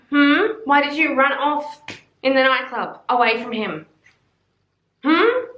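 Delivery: high teasing interrogation of her younger self; needling "Hmm?" that shoots up at the end; mock scolding.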